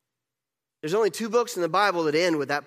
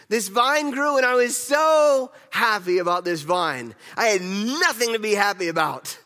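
louder, higher, slow and overjoyed; excited about the vine; 6 semitones higher, 6 dB louder, slower than usual. Acted. Jonah telling the congregation about his vine, loud and overjoyed. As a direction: gush over the vine like it saved you.

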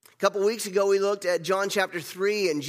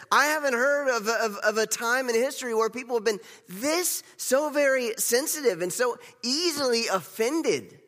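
higher and louder, rising indignation; heated; 8 semitones higher, 3 dB louder than usual. Real, not acted. Preacher decrying easily offended people with mounting heat. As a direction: build up the outrage.

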